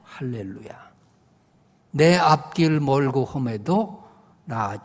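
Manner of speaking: declaimed verse, building from measured to loud; hymn verse recitation. Acted. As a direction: preach the poem line by line, growing in force.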